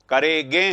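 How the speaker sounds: "करेगे" said as करेगें, nasal on the last vowel; misplaced nasal. Shown as a demonstration. Teacher shows what the misspelling gives, "करेगे" with nasal final गे. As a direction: nasalise only the end of "करेगे". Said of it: "हो जाएगा उच्चारण".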